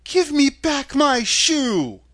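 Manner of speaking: high, loud, drawn-out demand; slow, high-pitched angry demand; angry little girl. A narrator's reading. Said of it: "grew angry and said to the witch".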